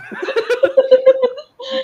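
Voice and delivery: big burst of laughing; loud high laughter. Real, not acted. Laughing at comment.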